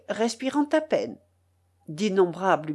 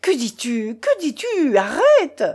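alarmed shouting; 5 semitones higher, 6 dB louder than usual. A narrator's reading. Uncle tries to halt the coachman, shouting. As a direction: cry building to a high, loud shout. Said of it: "voulut crier mon oncle".

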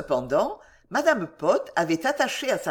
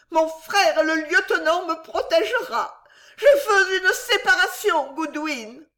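high, loud tearful threat; hysterical wife; 10 semitones higher, 4 dB louder than usual. A narrator's reading. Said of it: "continua madame Pott". (menace éplorée et suraiguë)

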